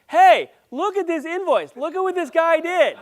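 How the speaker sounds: loud, high, hammed-up enthusiasm; excited showing off. Delighted client showing colleagues the invoice, acted loud and excited. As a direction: imitate an excited client calling colleagues over.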